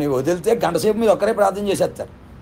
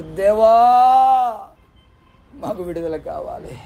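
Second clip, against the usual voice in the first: imitated wailing prayer; 4 semitones higher, 3 dB louder, much slower than usual. Enslaved people praying for deliverance, imitated wailing plea. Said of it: "ప్రార్థనలు ఏడుతున్నారు".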